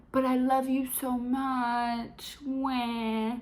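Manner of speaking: high, drawn-out lovesick whine; mock whiny pleading. Mocking the lovesick boyfriend's pleading.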